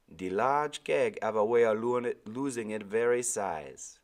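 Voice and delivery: put-on high, loud island-accented old man; old Caribbean sailor. Old sailor answering the boy, Caribbean accent. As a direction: give Timothy his lilting Caribbean voice. Timothy speaks.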